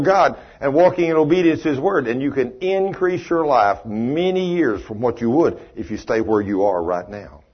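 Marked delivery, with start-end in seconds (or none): none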